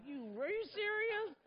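high-pitched disbelieving cry; incredulous. Retold or imitated. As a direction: squeal it in mock disbelief. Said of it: "I'm like".

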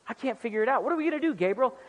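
lightly acted, baffled God; mock bewilderment, half amused; mock-helpless God. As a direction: play at being stumped for an answer. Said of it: "why do you say it like that".

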